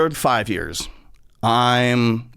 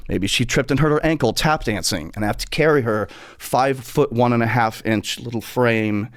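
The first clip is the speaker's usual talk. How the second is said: ordinary talk, nothing marked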